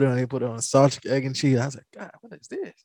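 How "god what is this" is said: mock disgusted surprise. Retells first taste of jelly.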